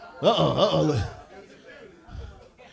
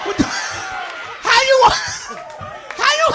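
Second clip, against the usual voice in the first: high, slow incredulous whisper then squeal; mock-shocked, rising to a high squeak; stunned disbelief; 9 semitones higher, slower than usual. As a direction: gasp it out in disbelief. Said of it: "I'm like".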